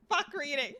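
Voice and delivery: ordinary talk, nothing marked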